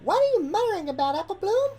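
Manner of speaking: fast, high puzzled question; quick confused demand; young filly voice. Acted. Sweetie Belle confused asks Apple Bloom, high and quick.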